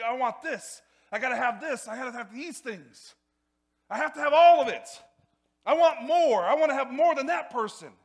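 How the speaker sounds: loud, high, escalating mock greed; entitled demanding. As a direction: play someone insisting on having everything. Mimicking entitled wanting.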